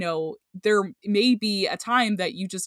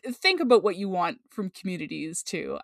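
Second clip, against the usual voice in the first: ordinary talk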